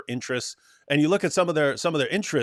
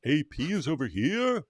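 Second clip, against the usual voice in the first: mock jihadi; 6 semitones higher, much slower than usual. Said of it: "And jihadis are like".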